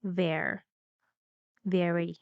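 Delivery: "very" with weight on VE, falling after; stressed first syllable. Shown as a demonstration. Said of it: "There is stress".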